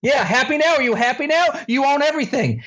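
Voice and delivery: high, sarcastic outrage; mock tirade. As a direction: hand it over in hammed-up bitter fury. Acts out his hysterical rant at son.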